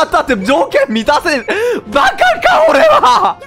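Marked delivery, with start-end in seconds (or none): 1.9-3.4 high-pitched laughing self-scold; much higher laughing mock self-scolding; laughing at himself. Real, not acted. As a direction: squeal at your own mistake, laughing.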